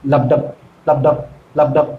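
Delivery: low, separate লাবডাব pulses; heartbeat rhythm. Shown as a demonstration. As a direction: beat out লাবডাব evenly, pausing between. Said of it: "হৃৎপিণ্ড ছন্দে যেরকম ভাবে".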